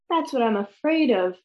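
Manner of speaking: plain reading voice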